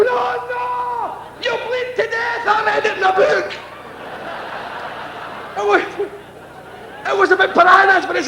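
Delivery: very high mock-panicked yelling; panicked child. Young boy warning the tamer about bleeding, high hammed-up panic. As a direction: scream a warning like a scared kid. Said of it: "I go".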